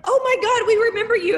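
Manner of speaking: high, loud thrilled gasp of recognition; excited recognition. Retold or imitated. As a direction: burst out like spotting old friends. Recognizing the couple a year later.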